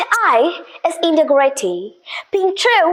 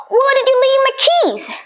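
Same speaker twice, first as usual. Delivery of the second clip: a sharp high keys question; high-pitched; 9 semitones higher than usual. Shown as a demonstration. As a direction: let the pitch jump right up on the question. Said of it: "slightly difference in those two deliveries".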